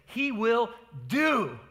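"do" drawn out and hit hard; slow, weighty punch on "do"; stress on DO. Shown as a demonstration. Preacher showing the congregation what the Greek emphasizes, stress on "do". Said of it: "wants to emphasize the doing".

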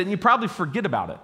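ordinary talk

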